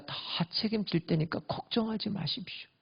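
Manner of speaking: softly whispered reassurance; gentle whispered comfort. Pretend. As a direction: hush them tenderly. Joseph reassures brothers.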